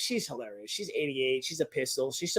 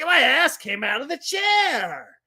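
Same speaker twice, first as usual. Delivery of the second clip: excited; 7 semitones higher, slower than usual. Real, not acted. Excited ride story.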